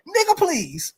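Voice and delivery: high-pitched mimicry of the dude; put-on high voice, mock dismissive. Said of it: "He was like".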